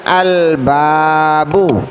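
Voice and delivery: slow "al babu", l fully sounded; clearly read al. Shown as a demonstration. Teacher models the next example, "al babu" with clear l. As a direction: draw out "al babu" with a distinct l and long first a. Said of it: "dibaca dengan jelas".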